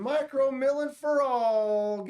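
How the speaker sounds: gleeful battle cry; excited cheer. Real, not acted. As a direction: proclaim it like a triumphant slogan. Cheering for the mill.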